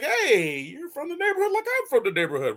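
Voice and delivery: high, fast mock buddy voice; friendly neighbor dude. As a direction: lay it on like a chummy local. Human greets robot as neighbor.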